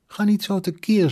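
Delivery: high-pitched telling-off; exasperated reproof. A narrator's reading. Mother to her cheering son, exasperated reproof. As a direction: tell him off for carrying on.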